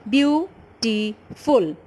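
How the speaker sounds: "Beautiful" broken into three slow beats; syllable by syllable. Shown as a demonstration. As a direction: stretch "Beautiful" out as beau - ti - ful. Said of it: "how many syllable".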